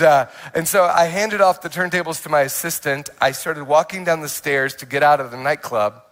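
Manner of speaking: ordinary talk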